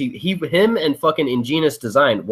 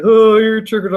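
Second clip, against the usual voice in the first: louder, higher taunting sneer; mocking the guy who woke him, sneering taunt; 7 semitones higher, 6 dB louder than usual. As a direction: mimic a smug online troll. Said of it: "And the guy's like".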